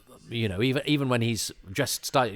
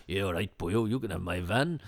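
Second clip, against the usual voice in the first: lightly voiced grumpy Welsh-ish milkman; a hint of a gruff local handing over his van; mild mock surly milkman. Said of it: "I can't see the surly milkman going".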